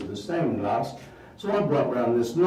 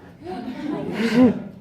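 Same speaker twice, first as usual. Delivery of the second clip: high giggle; 11 semitones higher, 3 dB louder than usual. Acted. Laughs after stunt.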